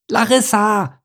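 high call for help; high, urgent call; mild shout. Acted. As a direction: call out to the turtle in alarm. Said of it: "schrie er".

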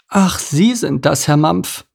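put-on high, drawn-out old lady voice; high-voiced elderly lady, taking her time; old mouse lady. A narrator's reading. Old mouse lady addresses Mike.

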